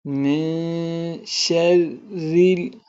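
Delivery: slow, melodic tajweed recitation; Quran recitation. Shown as a demonstration. Teacher reciting the opening for students, slow and melodic. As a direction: recite slowly, drawing out each vowel.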